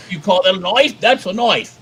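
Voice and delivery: hammed-up Australian movie-tough-guy line; Australian accent. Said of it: "best Australian accent you've ever done".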